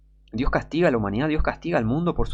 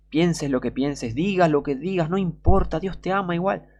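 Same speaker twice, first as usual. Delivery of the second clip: rising, higher singsong mockery; mocking recital; 6 semitones higher than usual. Preacher mocking the idea of unconditional love, mocking singsong. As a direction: parody a doctrine, pitch climbing as you go.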